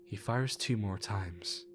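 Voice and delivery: ordinary delivery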